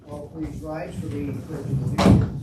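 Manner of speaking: projected call to the room; loud announcement. Real, not acted. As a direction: project it to the whole chamber. Asks the room to rise.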